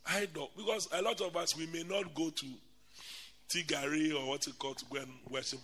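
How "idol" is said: usual speaking voice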